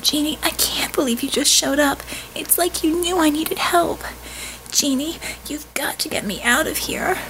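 high whispered plea; desperate whisper. Acted. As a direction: beg for help in a whisper. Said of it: "The girl's tone of desperation".

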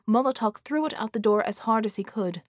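ordinary delivery

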